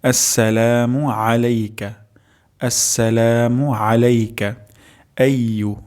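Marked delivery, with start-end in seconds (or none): 0.0-4.5 আসসালামু আলাইকা said slowly, every syllable clear; slow careful recitation. Shown as a demonstration. Teacher repeats the phrase slowly for the class. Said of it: "উচ্চারণটি হবে আলাইকা".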